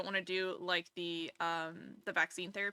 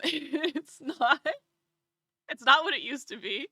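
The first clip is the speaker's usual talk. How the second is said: laughing; 4 semitones higher, 4 dB louder than usual. Real, not acted. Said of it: "destroyed her vocal box".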